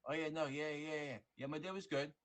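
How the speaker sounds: high, absent-minded yeahs; a lightly voiced partner half-listening while on the phone; distracted partner.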